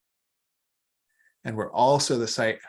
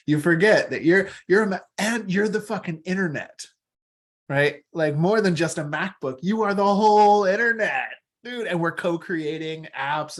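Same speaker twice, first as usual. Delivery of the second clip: excited build; 7 semitones higher, 4 dB louder than usual. Real, not acted. Excited riff, internet metaphor.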